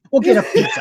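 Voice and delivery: laughing squeal. Pretend. Repeating the pizza punchline, laughing high.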